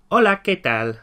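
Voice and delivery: bright, loud model of "Hola, ¿qué tal?" with OH-la stressed; teacher modelling "Hola, ¿qué tal?"; Spanish greeting. Shown as a demonstration. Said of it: "Then we'd say".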